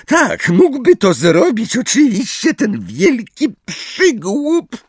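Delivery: very high, drawn-out mockery; derisive glee. A narrator's reading. Umbridge sneers about Hagrid, gleefully derisive. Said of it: "parsknęła śmiechem".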